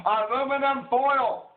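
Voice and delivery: high, slow, loud yell of "Aluminum foil"; drawn-out, high-pitched shout; emphatic correction. Pretend. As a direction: holler "Aluminum foil" as a mock-outraged correction. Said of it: "Learn how to pronounce it".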